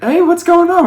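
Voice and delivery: high, bright mock mother's call. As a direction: pitch it way up like a curious mom. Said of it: "her mom was like".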